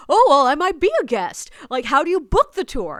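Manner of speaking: high, loud, pushy interest; eager stranger. Retold or imitated. Stranger angling to join the tour, eager. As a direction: imitate an over-keen man piping up. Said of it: "And he's like".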